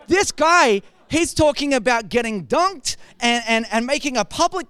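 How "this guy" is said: normal talking voice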